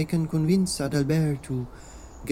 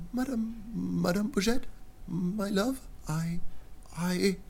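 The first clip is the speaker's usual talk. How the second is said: soft, dazed, apologetic murmur; sheepish quiet apology; 3 semitones higher, 5 dB quieter than usual. Acted. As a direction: whisper a sorry, just waking. Inventor waking, apologetic.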